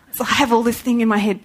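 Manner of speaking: normal talking voice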